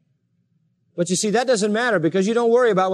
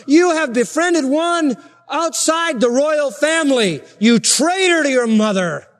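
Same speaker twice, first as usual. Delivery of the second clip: angry king; 7 semitones higher, slower than usual. Retold or imitated. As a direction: spell out each charge to a traitor son. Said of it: "What it's saying is this".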